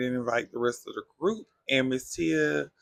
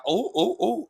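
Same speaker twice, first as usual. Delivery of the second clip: rising, higher oohs of scandal; mock gasps; 8 semitones higher, 4 dB louder than usual. The ladies reacting to the husband talk with rising mock gasps. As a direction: ooh like the table just heard shade. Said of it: "everybody was like".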